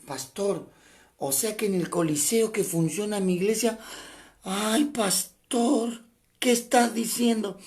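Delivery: hammed-up shocked believer; dismayed churchgoer. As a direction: play the scandalized church member, drawn out. Preacher voicing dismayed congregant.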